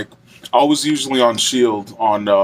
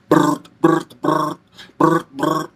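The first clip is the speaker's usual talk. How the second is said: vocal machine-gun brr bursts; machine gun sound; 4 semitones higher, slower than usual. Retold or imitated. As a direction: spit out rapid gun bursts. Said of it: "like a machine gun sound".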